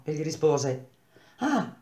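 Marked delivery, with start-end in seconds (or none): 1.4-1.7 high, soft little "ah" of realisation; light, softly voiced exclamation. A narrator's reading. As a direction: let out a small, high exclamation. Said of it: "esclamò lei e rise".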